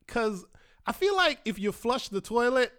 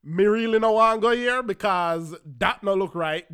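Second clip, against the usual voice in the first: Jamaican patois line with the stress on "want"; "want" leaned on in patois; patois protest, "want" stressed; 4 dB louder than usual. Retold or imitated. Patois quote, first take.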